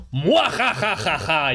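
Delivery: slow hammed-up evil cackle; cartoon villain gloating laugh; mustache twirling villain. Mock villain laugh in quote.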